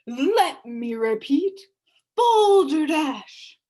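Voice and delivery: drawn-out, loud booming scoff; pompous beetle bellowing his scorn; booming shout. A narrator's reading. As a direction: boom it out to silence the room. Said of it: "he shouted again".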